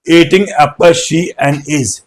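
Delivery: jumbled words "eating apple she and is" spoken very slowly; slow scrambled example. Shown as a demonstration. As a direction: show the broken order one word at a time. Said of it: "और अगर मैं ये करूं". (गड़बड़ क्रम "eating apple she and is" बहुत धीरे)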